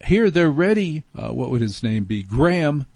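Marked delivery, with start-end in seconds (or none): 0.1-1.0 higher, lightly acted call into another room; mock calling out. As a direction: call out without really shouting. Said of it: "you shout into the locker room".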